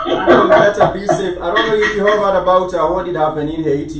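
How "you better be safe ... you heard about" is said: laughing. Real, not acted. Laughing warning about hurricane.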